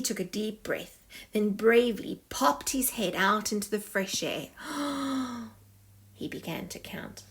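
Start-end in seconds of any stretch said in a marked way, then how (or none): 4.5-5.5 gasp for air. Acted. Head pops out of water.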